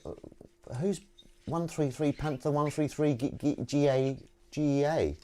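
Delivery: ordinary delivery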